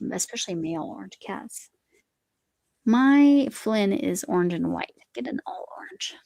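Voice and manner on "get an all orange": conspiratorial whispered advice; whispered tip. Real, not acted. Aside tip to a viewer.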